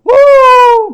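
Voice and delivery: high, loud eerie bird hoot; piercing, long-held "woo" call; imitated bird call. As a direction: imitate an unidentified night bird. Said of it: "막 이런 소리가 나와".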